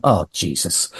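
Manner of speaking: low under-the-breath Oh, Jesus; muttered dismay. Retold or imitated. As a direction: mutter it to yourself, deflated. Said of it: "I'm just looking at him going".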